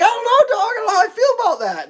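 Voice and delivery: very high, put-on doubtful voice; high-pitched playful unease; mock misgivings. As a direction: squeak out exaggerated doubt to a dog. Answering the suddenly friendly dog.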